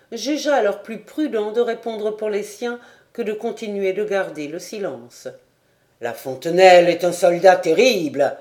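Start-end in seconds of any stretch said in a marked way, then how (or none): 6.0-8.4 loud, resonant proclamation; sonorous, ringing voice. A narrator's reading. Lieutenant answers the sergeant, loud and ringing. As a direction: let it ring out across the tavern. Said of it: "d'une voix sonore et vibrante".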